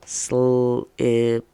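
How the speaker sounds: "Slipped" stretched out sound by sound; drawn-out sounding out; really slow word. Shown as a demonstration. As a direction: sound out "Slipped" slowly, ending on a crisp t. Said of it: "say the word really slowly".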